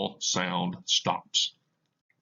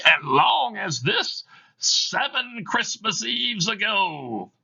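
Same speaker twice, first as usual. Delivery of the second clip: a chain-bound spirit intoning its doom; Marley's ghost; 10 semitones higher, 5 dB louder than usual. Acted. Reads Marley's ghost in the play.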